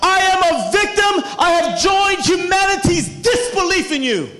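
high, shouted sermon delivery; loud, sustained preacher's cry; full-force preaching. Real, not acted. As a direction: work the congregation up at full volume.

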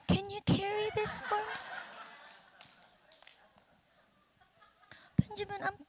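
high, soft wheedling; helpless wife plea. Acted. Wife wants Benjamin to fetch for her, helpless plea. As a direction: ask in a small high voice, playing helpless.

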